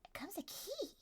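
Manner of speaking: a hushed whisper. Acted. GM whispering the reveal to the players, hushed. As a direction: breathe the reveal.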